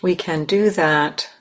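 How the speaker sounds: ordinary talk, nothing marked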